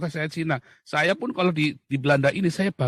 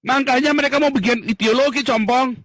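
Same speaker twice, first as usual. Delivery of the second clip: high, loud scornful outburst; angry rant; 8 semitones higher, 6 dB louder than usual. Real, not acted. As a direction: blast them with contempt. Ranting at government. (luapan marah keras dan tinggi)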